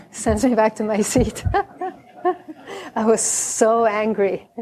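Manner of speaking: normal talking voice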